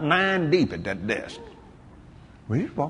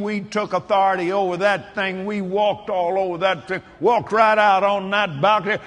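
usual speaking voice

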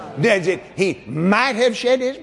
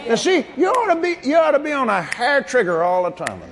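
normal talking voice

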